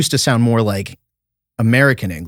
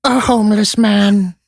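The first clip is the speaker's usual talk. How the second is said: Attenborough-style narration; 10 semitones higher, 3 dB louder than usual. Pretend. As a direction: narrate a homeless man like a wildlife scene, with mock gravity. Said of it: "Do a homeless person on Sixth Street".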